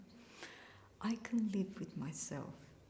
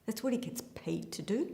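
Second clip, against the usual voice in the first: bitter; 10 dB louder than usual. Acted. Mother snaps about the lawyer.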